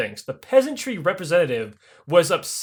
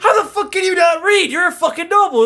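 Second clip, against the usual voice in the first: much higher, louder disbelieving yell; incredulous shout; 12 semitones higher, 5 dB louder than usual. Retold or imitated. Catherine yells at noble who can't read, high incredulous shout. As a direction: scream at him in disbelief, pitch way up.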